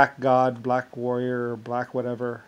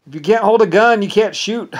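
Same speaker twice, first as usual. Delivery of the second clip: higher, louder mock order, quoting Papa; Papa's loud command; 7 semitones higher, 9 dB louder than usual. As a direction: bark it out like a gang boss laying down the law. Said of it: "Papa said".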